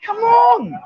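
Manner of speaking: a sudden squeaky shout at a friend; high shout. Real, not acted. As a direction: yell at him to snap out of it. Mock rallying shout.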